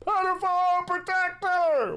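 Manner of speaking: very high, drawn-out shout; imitated heckler's yell. Guy heard at the start of the video, yelled. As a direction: re-enact a heckler yelling across the room. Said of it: "The guy goes".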